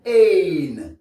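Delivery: "ain" as long AY with sounded N; English AI plus N. Shown as a demonstration. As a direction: stretch "ain" and finish on the N. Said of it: "A plus N". (« ain » allongé à l'anglaise, N prononcé)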